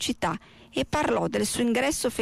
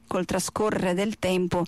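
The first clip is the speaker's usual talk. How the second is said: ordinary delivery, nothing marked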